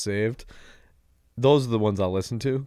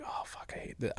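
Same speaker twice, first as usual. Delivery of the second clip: hungover whisper under the breath; 12 dB quieter than usual. Retold or imitated. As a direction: whisper it like you hope nobody hears. Hungover fan encounter, whispered.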